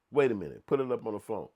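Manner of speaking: ordinary delivery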